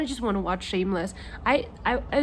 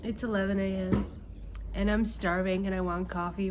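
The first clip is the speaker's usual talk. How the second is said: normal talking voice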